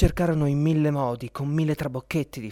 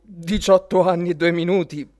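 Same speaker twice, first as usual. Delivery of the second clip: "diciotto" stressed, louder and higher, scornful echo; Papageno mocking the old woman; 5 semitones higher, 7 dB louder than usual. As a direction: throw her words back at her, louder and higher. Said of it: "pensò Papageno".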